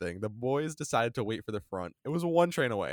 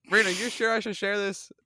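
laughing nervously; 10 semitones higher, 5 dB louder, faster than usual. Real, not acted. Nervous before embarrassing story.

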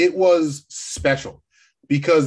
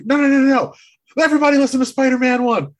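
high, fast, loud mock protest; hurried, high-pitched acted objection; excited interjection; 9 semitones higher, 4 dB louder, faster than usual. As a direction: cut in urgently, raised voice, imitating the character. Acting out Spider-Men numbering each other.

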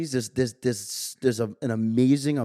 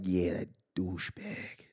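fake Jersey accent; 6 semitones lower, 10 dB quieter, slower than usual. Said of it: "I catch myself ... talking normal".

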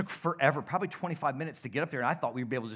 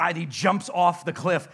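ordinary delivery

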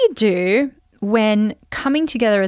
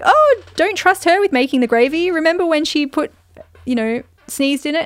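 mocking relatives; 7 semitones higher, 4 dB louder than usual. Relatives voiced, mocking her childhood mistake.

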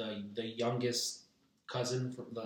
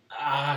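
loud, higher drawn-out cry of dismay; retold exasperated "ah"; 4 semitones higher, 10 dB louder than usual. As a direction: let out a loud mock-panicked "ahh". Retold reaction to the fight.

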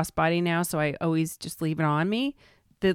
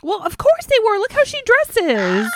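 high, loud, knowing sarcasm; sarcastic dig about her flashy outfits; arch sarcasm; 13 semitones higher, 6 dB louder than usual. Real, not acted. Sarcastic remark on Dorit's dress.

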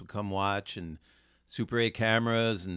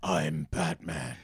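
low, slow growl; gravel-throated Batman; 5 semitones lower, slower than usual. Retold or imitated. Batman impression.

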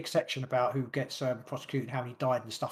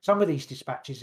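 ordinary delivery

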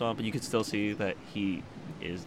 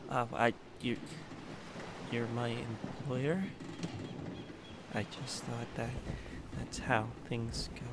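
timid; 9 dB quieter than usual. Acted. Riley answering the captain nervously.

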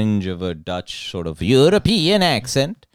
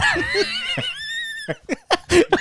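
high shrieking laugh; 3 dB quieter than usual. Real, not acted. Big laugh at bit.

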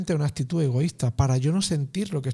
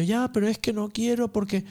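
put-on higher whiny child's protest; a lightly voiced sulky kid, much higher; the child objecting; 7 semitones higher than usual. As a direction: voice a child who won't accept it. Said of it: "Y el niño".

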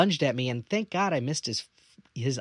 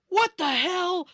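high-pitched, drawn-out mock yell; angry dad yelling; 3 dB louder, slower than usual. Dad finds broken bathroom door, yelling at sons. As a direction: imitate a furious dad hollering from another room.

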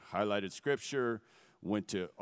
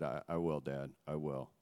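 tearful; 5 semitones lower, 5 dB quieter than usual. Retold or imitated. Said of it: "the tears in my eyes and crying".

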